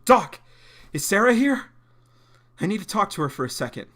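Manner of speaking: loud, high urgent plea. Acted. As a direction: burst in demanding. Voicing urgent John.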